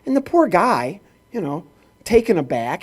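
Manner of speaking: usual speaking voice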